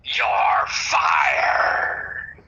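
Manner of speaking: deep barked you're fired; Vince McMahon impression. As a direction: boss firing someone.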